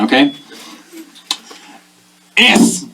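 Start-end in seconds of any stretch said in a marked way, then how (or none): 2.4-2.8 put-on high-pitched German-accented teacher drilling letters; hammed-up German spelling drill. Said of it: "This time I'm German".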